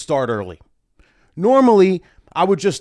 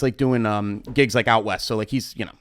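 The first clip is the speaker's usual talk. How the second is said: usual speaking voice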